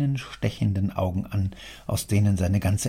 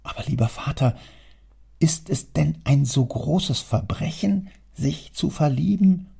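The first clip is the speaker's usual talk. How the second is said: rising, higher plea to father; half friendly, half reproachful; 7 semitones higher than usual. A narrator's reading. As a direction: gently reproach your father. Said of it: "halb freundlichen, halb vorwurfsvollen Miene". (Sohn halb freundlich, halb vorwurfsvoll zum Vater)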